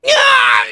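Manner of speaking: high shouted cry; pained yell. Acted. Fighter takes a blow, high pained yell. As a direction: cry out sharply as if struck. Hit in battle.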